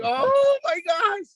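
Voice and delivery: long drawn-out high wail; hammed-up, high-pitched dread; mock dismay. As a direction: stretch it out in comic panic. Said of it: "me and Destiny are all like".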